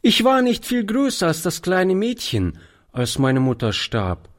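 high, lightly voiced young boy; a ragged boy chatting to an old man; lightly voiced cheeky street boy. A narrator's reading. Boy tells his story.

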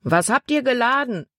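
man calling out. A narrator's reading. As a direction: shout the question up to the boat. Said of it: "rief ein Mann". (Mann ruft vom Ufer)

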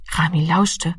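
ordinary delivery, nothing marked

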